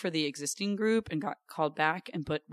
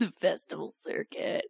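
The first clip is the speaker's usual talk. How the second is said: put-on circuit voice; 3 dB quieter than usual. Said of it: "I say it with that voice".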